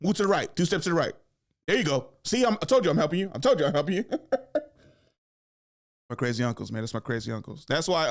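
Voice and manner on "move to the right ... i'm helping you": higher, louder bossy coaching. Retold or imitated. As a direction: boss them around like a lazy supervisor. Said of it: "that person coaching you".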